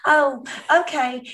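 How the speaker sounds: high, bright-pitched Southern greeting; Southern accent, loud hello easing off. Retold or imitated. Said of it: "greeting everybody with a Southern accent".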